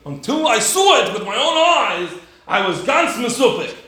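high, loud incredulous quoting; emphatic mocking imitation; mock confession. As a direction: quote it back as if it were outrageous. Mockingly quotes the doubter.

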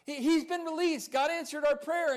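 high, loud, thrilled outburst; so excited. Retold or imitated. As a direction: act out bursting with good news. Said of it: "she was so excited".

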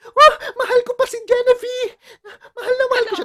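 put-on loud drunken wailing, high and crying; blackout drunk. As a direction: wail like a heartbroken drunk. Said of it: "lasing na lasing ka, dun nagwawala".

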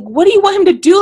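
high, loud exasperated outburst; frustrated. Retold or imitated. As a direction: demand it in exasperation. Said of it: "the part that frustrated me".

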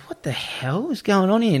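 high, stunned what-is-happening; baffled disbelief. Retold or imitated. Travellers puzzled by fleeing kids, high baffled question. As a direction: ask it like you can't believe your eyes.

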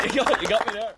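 laughing. Real, not acted. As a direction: squeak it out mid-laugh. Laughing after interview.

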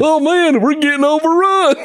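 high, goofy cry of alarm; goofy panic. Retold or imitated. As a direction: play a cartoonish soldier getting overrun. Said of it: "He's like".

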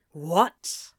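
breath-only, baffled question; whispered bewilderment; utterly confused. A narrator's reading. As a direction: drop the hostility, purely puzzled. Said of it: "to be so utterly confused".

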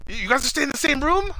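high-pitched, disbelieving question; incredulous driver. Retold or imitated. Driver grilling about shared room.

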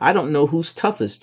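plain reading voice

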